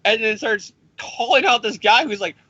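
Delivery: normal talking voice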